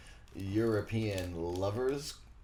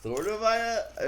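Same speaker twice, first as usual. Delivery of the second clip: mock English accent; 13 semitones higher, 3 dB louder, slower than usual.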